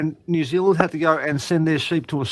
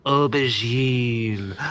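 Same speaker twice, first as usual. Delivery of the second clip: slow, drawn-out posh-sounding aubergine with a lower pitch; mock-refined aubergine, stretched out and pitched lower; fancy pronunciation; 5 semitones lower, 4 dB quieter, much slower than usual. Shown as a demonstration. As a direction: say aubergine as if it were a delicacy. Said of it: "if you wanna be fucking fancy".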